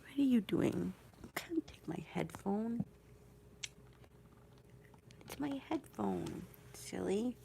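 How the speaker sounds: hushed playful scolding whisper. Real, not acted. Whispering to her cat over the headphones, playfully scolding. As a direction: whisper a soft telling-off.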